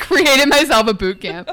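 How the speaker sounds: laughing. Shown as a demonstration. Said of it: "quote of the episode".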